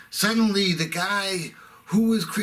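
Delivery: ordinary delivery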